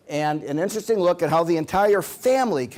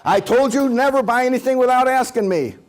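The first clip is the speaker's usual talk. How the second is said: loud, higher scolding wife; yelling wife; 8 semitones higher, 5 dB louder than usual. Retold or imitated. Wife scolding husband again, loud and angry. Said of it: "when your wife's yelling at you".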